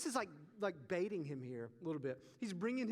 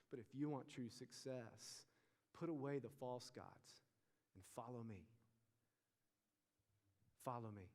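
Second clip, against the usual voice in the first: quiet altar call; 5 semitones lower, 7 dB quieter than usual. Real, not acted. Preacher softly inviting listeners to follow. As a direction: draw them in gently.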